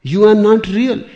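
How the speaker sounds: very loud, high declaration; acted shout of denial; emphatic rebuke. Acted rebuke of someone's illusion.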